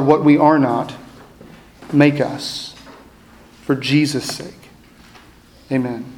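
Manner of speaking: slow, hushed prayer with long pauses; pastoral prayer. Acted. Pastor praying over his congregation, slow and hushed. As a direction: pray it gently, letting each line settle.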